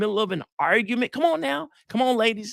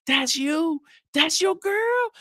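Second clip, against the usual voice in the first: high, excited admiring friend; hyped friend; 11 semitones higher, slower than usual. Retold or imitated. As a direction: hype your boy's girl up. Other men admiring again.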